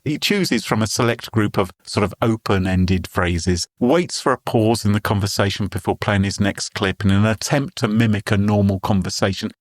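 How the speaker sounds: usual speaking voice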